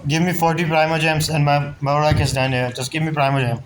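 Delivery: normal talking voice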